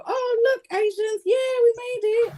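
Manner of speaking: high, sing-song mock politician voice; mocking Rishi Sunak. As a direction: sneer through a fake proud announcement. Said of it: "he did his little Diwali thing outside his house to show".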